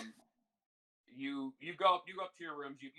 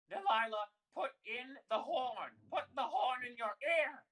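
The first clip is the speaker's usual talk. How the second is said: high-pitched hollering at someone half-deaf; yelling; 11 semitones higher, 5 dB louder than usual. Acted. Old sisters tell Delilah to use ear horn, yelling. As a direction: holler it impatiently, much higher. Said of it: "yelling at the other two".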